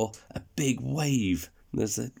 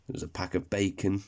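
plain reading voice

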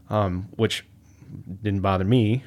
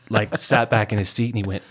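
ordinary delivery

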